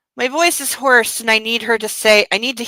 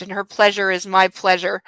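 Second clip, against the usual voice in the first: usual speaking voice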